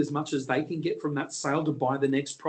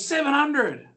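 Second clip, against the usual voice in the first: bloke shouting a bid; 10 semitones higher, 3 dB louder, slower than usual. Retold or imitated. Heckler shouting his bid.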